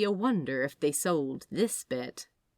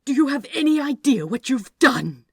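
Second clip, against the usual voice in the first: loud, high furious demand; loud furious scolding; angry berating; 8 semitones higher, 11 dB louder than usual. Acted. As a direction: tear into him. Angel berates another angel.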